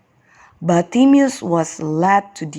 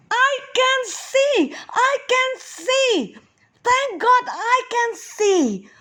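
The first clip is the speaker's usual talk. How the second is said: joyful exclaim. Acted. Healed Bartimaeus cries out, overjoyed. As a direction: burst out in pure delight. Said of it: "so happy he could not keep quiet".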